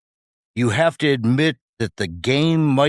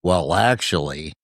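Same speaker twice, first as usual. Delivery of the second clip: know-it-all correction; 8 semitones lower than usual. Retold or imitated. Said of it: "somebody puts down their fork and says".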